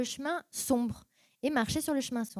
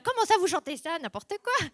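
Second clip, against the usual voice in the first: indignant protest; 7 semitones higher, 4 dB louder than usual. Retold or imitated. Retelling her indignation at church.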